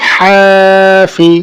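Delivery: slow, level, sustained first vowel in "হাফি"; stretched reading. Shown as a demonstration. Teacher reading through for students, "হা" drawn out. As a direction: hold "হা" long in "হাফি", then short "ফি". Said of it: "টানা পড়ে চলে যাবো".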